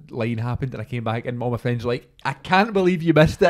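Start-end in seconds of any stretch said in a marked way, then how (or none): 2.2-3.5 loud, high-pitched retold exclamation; mock disbelief. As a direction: exclaim it like incredulous friends. Friends' disbelief in a retold anecdote.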